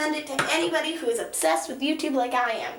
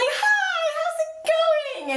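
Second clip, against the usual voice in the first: very high, strained squeak of a hello; squeaky high greeting; slower than usual. Retold or imitated. Fan greeting her idols in a tiny squeaky voice. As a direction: squeak it out, starstruck and nervous. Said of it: "Feels like".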